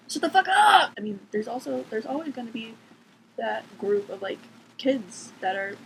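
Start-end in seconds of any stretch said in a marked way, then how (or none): 0.1-0.9 loud, higher, slowed-down shout; yelling at the cats. Real, not acted. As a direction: snap at the cats to shut up.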